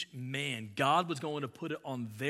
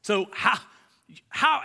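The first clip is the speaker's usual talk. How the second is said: high, loud disbelief building; mock their baffled protest; incredulous mock; 8 semitones higher, 6 dB louder than usual. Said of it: "their side of it is like".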